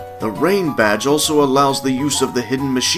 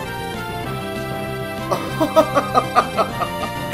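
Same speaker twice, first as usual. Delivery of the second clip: long, high laugh; staged high-pitched laughter; theatrical laugh; 11 semitones higher, 4 dB quieter than usual. Acted. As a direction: laugh grandly, high and drawn out. Gym leader laughs theatrically.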